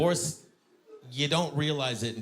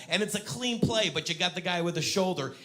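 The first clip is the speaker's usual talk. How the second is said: normal talking voice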